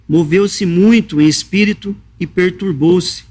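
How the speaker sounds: normal talking voice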